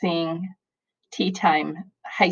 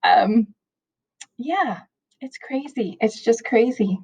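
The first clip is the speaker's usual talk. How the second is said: teary voice; 5 semitones higher, slower than usual. Real, not acted. Teacher trying to steady herself for students, teary. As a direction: push through a lump in the throat. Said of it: "I'm not a crier".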